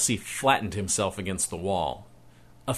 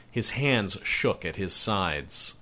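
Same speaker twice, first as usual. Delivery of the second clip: ordinary delivery, nothing marked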